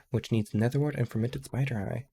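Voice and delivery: normal talking voice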